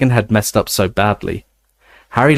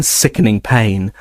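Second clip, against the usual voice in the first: usual speaking voice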